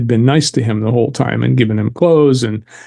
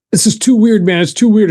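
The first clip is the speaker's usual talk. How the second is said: higher, rattled and spooked; 9 semitones higher than usual. Retold or imitated. Shaken gunman telling his victim it's too weird, high and spooked. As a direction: voice the shooter's unease, pitched up.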